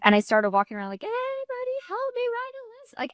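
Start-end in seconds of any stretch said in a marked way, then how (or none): 1.0-2.9 mock helpless call. Calling out in empty office.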